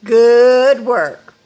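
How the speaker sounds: loud, bright praise. Real, not acted. Teacher praising the kids loudly for good work. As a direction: cheer them on loudly.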